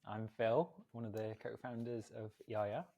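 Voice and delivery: slightly stilted podcast intro, rising at the end; staccato, awkward introduction. Real, not acted. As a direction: introduce yourself a little awkwardly, softly. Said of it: "that slightly weird staccato, awkward".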